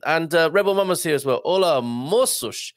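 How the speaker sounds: ordinary talk, nothing marked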